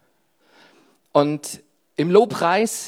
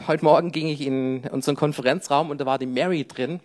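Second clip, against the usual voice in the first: usual speaking voice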